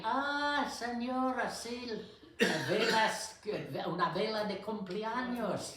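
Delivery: very high, loud imitation of a store clerk; hammed-up helpful Spanish-speaking clerk; instant recognition. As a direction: exclaim like a clerk who suddenly gets it. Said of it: "the guy or the girl said immediately".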